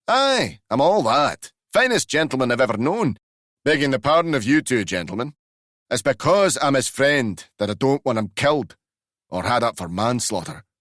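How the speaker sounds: old Glasgow driver defending himself, hammed up; Glasgow accent. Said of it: "a very powerful Glasgow accent".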